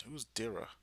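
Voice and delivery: confused whisper to yourself; whispered aside. Real, not acted. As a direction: whisper the question. Confused aside while reading.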